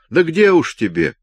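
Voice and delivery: high, loud, mocking taunt; gloating. Robert gloating at the sleepy Patrick, mocking taunt. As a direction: rub it in with glee. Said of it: "злорадно сказал Роберт".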